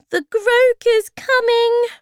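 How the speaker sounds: very high, loud, slow warning; alarmed little creature voice; tiny creature's alarm. A narrator's reading. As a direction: squeal the warning out. Worried Bob warns of the Groke.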